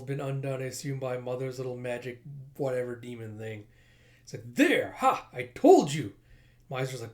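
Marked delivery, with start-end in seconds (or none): 4.5-6.1 loud, high crowing; triumphant gloating. Acted. Villain spotting hidden foes, loud triumph. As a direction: crow over being proved right.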